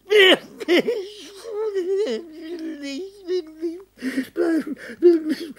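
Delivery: sobbing plea. Acted. Sobbing refusal in delirium.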